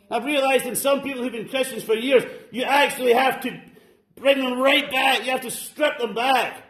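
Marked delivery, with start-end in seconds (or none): none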